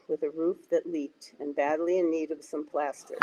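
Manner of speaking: usual speaking voice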